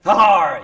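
loud, high pirate-style "har"; mock pirate "arr". Actor playing along with the pirate joke, mock pirate cry. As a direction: bellow a playful pirate growl-cry.

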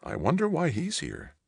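high-pitched wondering; mother's high voice, wondering aloud; puzzled surprise. A narrator's reading. Mother wondering at the mayor's visit, surprised.

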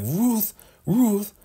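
very high pleading call; high squeaky appeal to Ruth; high plea. Retold or imitated. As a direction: cry her name high and pleading. Elder Mother pleading with Ruth.

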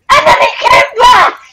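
high-pitched, shouted story twist; sudden shout. Real, not acted. Telling friend the pizza guy came back, sudden shout. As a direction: burst out loudly, far above normal pitch.

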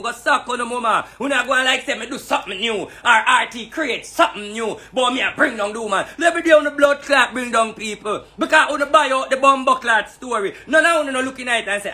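ordinary talk

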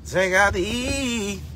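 slow call-out, last vowel held; drawn-out shout-out. Real, not acted. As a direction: stretch the final E long. Shout-out with stretched final letter.

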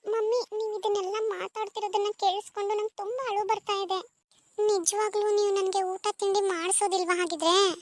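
put-on a little girl on the verge of crying, mildly; tearful little girl. (ಅಳು ಬರುವ ಮಗುವಿನ ಧ್ವನಿ)

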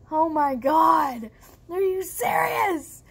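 slow, drawn-out exasperation; acted-out incredulous groan of words; appalled disbelief. Reacting to sister's excuse.

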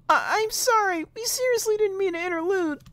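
very high little character voice; squeaky apology. Acted. Voicing an apologetic game character.

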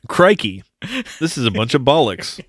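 a jokey Australian outburst; mock Australian accent. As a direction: ham up a bad Australian accent. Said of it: "what I was thinking in this scene was".

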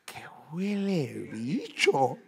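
muy lenta, alargando las palabras; frase al espejo actuada. Acted. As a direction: estira cada palabra frente al espejo. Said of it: "me miro al espejo y hago". (frase alargada y teatral)

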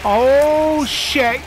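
high, loud, stretched-out panic; alarmed cry. Real, not acted. Player panicking mid-fight, high loud alarm. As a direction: cry out as danger closes in.

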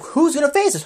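high-pitched playful challenge; challenging New Day. Retold or imitated. New Day challenging the locker room, high mock hype. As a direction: call out a cocky challenge, high. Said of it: "then they're like".

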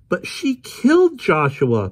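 put-on high, loud, slow outraged girl; girl crying out. Polly protesting to Adam, high slow outraged cry. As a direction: hurl the words in disbelief. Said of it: "Polly cried".

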